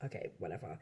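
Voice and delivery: low, very soft, resigned grumble; grudging. Retold or imitated. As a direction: give in reluctantly under your breath. Retelling reluctant reply to producer.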